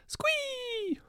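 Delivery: very high gleeful squeal; squee of delight. A narrator's reading. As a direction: cut him off with pure glee. Girl interrupts with delight.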